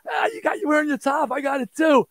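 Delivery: put-on a bandmate gushing to his girlfriend about their matching tops, giddy; giddy excitement. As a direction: squeal it like a thrilled kid. Bounding off stage, thrilled.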